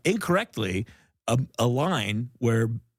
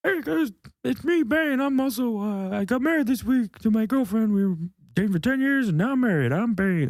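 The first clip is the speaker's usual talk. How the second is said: high silly villain voice; Bane impression; 9 semitones higher than usual. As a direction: do a goofy Bane. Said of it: "Bane impression".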